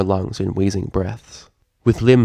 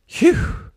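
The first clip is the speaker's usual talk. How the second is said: relieved phew. Acted. Relieved Twilight after essay.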